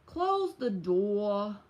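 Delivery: put-on slow, drawn-out New York accent. Teasing a viewer from New York City about how they talk, in a slow mock New York accent. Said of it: "do they talk like this".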